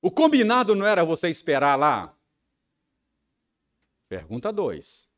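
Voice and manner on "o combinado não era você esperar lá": loud, fast, higher accusing yell; husband yelling at his wife about waiting; angry shout. Retold or imitated. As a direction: shout the reproach at her. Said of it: "Porque eu tava gritando".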